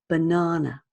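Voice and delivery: "banana" with lazy schwas, buh-NAH-nuh; weak uh vowels. Shown as a demonstration. Teacher showing the lazy uh in banana. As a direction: relax the first and last a of "banana" to uh. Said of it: "very lazy uh sound".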